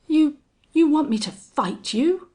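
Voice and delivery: high, loud, halting disbelief; hoarse query. A narrator's reading. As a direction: stumble into a shocked high question. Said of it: "he queried in hoarse accents".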